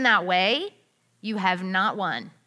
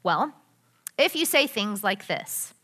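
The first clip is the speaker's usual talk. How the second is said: ordinary delivery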